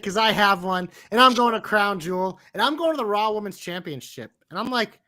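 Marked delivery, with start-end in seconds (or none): none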